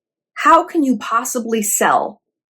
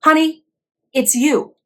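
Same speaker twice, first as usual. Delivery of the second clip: usual speaking voice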